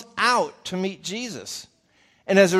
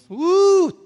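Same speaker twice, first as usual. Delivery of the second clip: woo; 9 dB louder than usual. Retold or imitated. Martha recoils at the smell.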